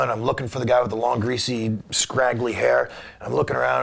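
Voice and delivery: usual speaking voice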